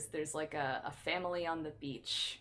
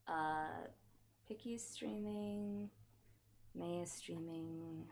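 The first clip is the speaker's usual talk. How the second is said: softer, slow reading aloud; muttering; 10 dB quieter, much slower than usual. Real, not acted. Streamer softly checking who is live. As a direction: mutter while checking the list.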